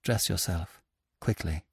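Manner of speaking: low, soft firm order; commanding. A narrator's reading. The woman ordering weak Lupin, quiet command. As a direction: give the order quietly, no argument. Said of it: "she commanded".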